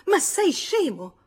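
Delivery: sharp outburst, high and loud; loud, high-pitched snapping retort; brusque. A narrator's reading. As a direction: burst out in a brusque, indignant rebuke. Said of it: "disse brusca".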